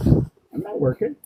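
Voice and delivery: high, slow mock protest; put-on protesting voice. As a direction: play a high-voiced refusal to work.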